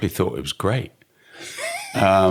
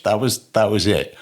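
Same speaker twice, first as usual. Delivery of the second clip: ordinary talk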